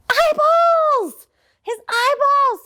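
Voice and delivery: high, loud panicked cry; frightened shriek. Acted. Bebe shrieking at eyeballs in the soup, panicked. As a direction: shriek at what you see in the pot.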